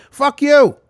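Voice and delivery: loud, high, dismissive blast; angry shout. Acted. To the friend who won't leave the quicksand, a furious shout. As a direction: spit it out at him, fed up.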